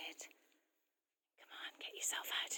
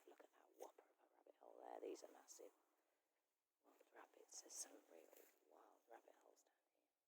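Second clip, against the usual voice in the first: low, very hushed aside; whispering a bit; 11 semitones lower, 16 dB quieter than usual. Real, not acted. Pointing out wild rabbit holes to viewers, whispering. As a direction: drop right down to a soft near-whisper. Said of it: "I've been whispering a bit".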